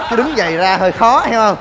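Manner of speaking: normal talking voice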